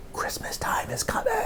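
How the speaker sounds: hushed secret whisper. Acted. Host whispering the season's secret to the children.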